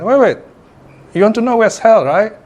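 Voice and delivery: urgent interjection. Acted. Monk stops the swordsman chasing him, urgent interjection.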